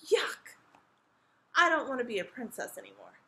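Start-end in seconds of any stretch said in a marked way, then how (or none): 0.1-0.6 short, loud, disgusted burst; exaggerated childish revulsion. A narrator's reading. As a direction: spit it out in mock disgust. Princess disgusted by princess life.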